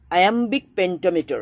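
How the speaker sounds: slow, emphatic term. Shown as a demonstration. Teacher naming the meter for students, slow and emphatic. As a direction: say iambic pentameter very slowly, each syllable drawn out. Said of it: "that is what?".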